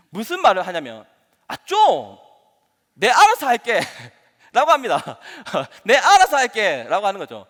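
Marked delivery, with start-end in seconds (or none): 1.5-3.8 loud, higher imitation of a child pushing parents away; mock a child's loud protest; irked growing child. Growing child talking back to parents, irked mock outburst. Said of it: "조금 아이가 성장하면 무슨 말을 할까요?". 5.8-6.8 loud, higher mock protest, words distinct; irked growing child. Child telling parents to back off, loud irked imitation. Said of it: "청소년들은 발음이 또 제대로 안 될 때가".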